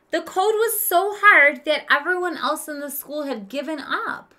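lively read-aloud for a class; expressive story narration. Acted. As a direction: read the story to the children with character. Teacher reads story to class.

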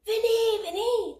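high, loud beckoning; eager calling. A narrator's reading. Teddy at the living-room door, calling excitedly. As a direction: call them over eagerly. Bear calls toys in.

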